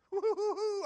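big high joyful laugh; preacher laughs out to the congregation; loud laugh. Real, not acted. Hearty burst of laughter.

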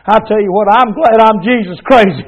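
usual speaking voice

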